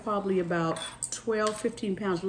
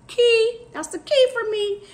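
higher and louder, burst out on key; excited emphasis; 14 semitones higher, 8 dB louder than usual. Real, not acted. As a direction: hit key like a revelation. Stressing her fitness secret.

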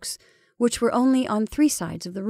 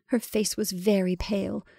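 plain reading voice

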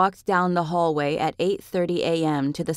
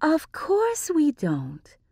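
woman's voice; 10 semitones higher, much slower than usual. A narrator's reading.

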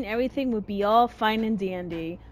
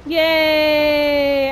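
louder cheer; happy cheer; 3 semitones higher, 5 dB louder than usual. Real, not acted. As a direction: cheer in relief. Reaching the goal.

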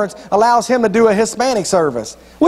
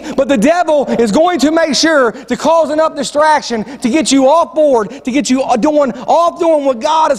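raised-pitch sermon delivery; emphatic preaching; 6 semitones higher than usual. Real, not acted. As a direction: drive the point home to the congregation, drawing out key phrases. Preaches the point with force.

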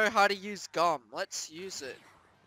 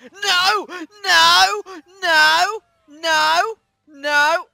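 loud higher repeated no; shouted no's; 8 semitones higher, 11 dB louder, much slower than usual. Real, not acted. Gamer yelling as he gets hit, loud protest.

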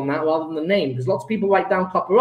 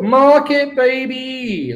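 excited; 6 semitones higher, slower than usual. Real, not acted. Celebrating finishing the paper.

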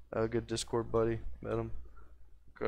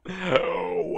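burst of laughter; 9 semitones higher, 7 dB louder than usual. Real, not acted.

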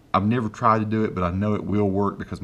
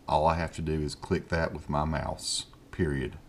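measured dictation, dropping lower at the end; dictation to software; 3 semitones lower, 5 dB quieter than usual. Real, not acted. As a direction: dictate deliberately, punctuation spoken aloud. Dictates to software, punctuation spoken.

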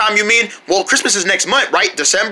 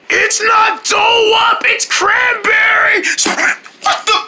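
insistent shout; 12 semitones higher, slower than usual. Acted. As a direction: hammer it home, slowly. Arguing over the cranberry.

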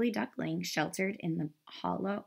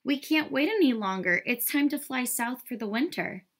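higher, urgent mother duck; Mother Duck calling; 6 semitones higher, 3 dB louder than usual. A narrator's reading. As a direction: keep calling to the missing duckling. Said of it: "quacked Mother Duck".

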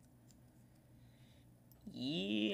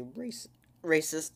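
voice crack; 3 semitones higher, 7 dB louder than usual. Real, not acted. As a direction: voice breaking mid-word. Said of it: "Voice crack".

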